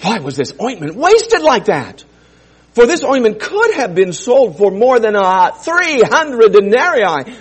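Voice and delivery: the disciples grumbling at the woman, indignant. Acted. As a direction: scoff at the waste. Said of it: "said to themselves indignantly".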